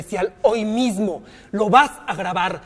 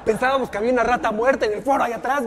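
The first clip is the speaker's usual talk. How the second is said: ordinary talk, nothing marked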